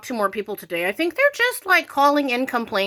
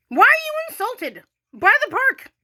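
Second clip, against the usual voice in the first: high rising, disbelieving challenge; mocking incredulity; 10 semitones higher than usual. Real, not acted. Demanding why critics hate her park post, high mocking incredulity. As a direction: throw the question at the complainers. Mocking the people who complained.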